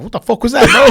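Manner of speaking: high, loud laughing disbelief; mock disbelief. Cohost teasing host after the hug, laughing disbelief. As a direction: tease the friend, laughing.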